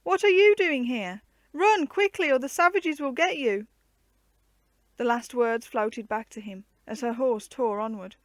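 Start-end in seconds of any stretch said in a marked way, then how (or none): 0.0-3.7 high, loud, urgent cry; frightened warning. A narrator's reading. Anne warning Guy of the savages, frightened high cry. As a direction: scream at him to run. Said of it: "She was frightened".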